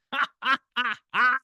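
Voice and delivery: short burst of suppressed laughter; stifled laugh. Real, not acted. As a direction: laugh while trying to hold it back. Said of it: "I'm trying not to laugh".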